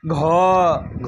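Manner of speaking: घ voiced with a long breathy release; घ held longer with breath, mahaprana; aspirated gha. Shown as a demonstration. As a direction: let breath rush out after घ. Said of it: "घ में ज्यादा लगता है".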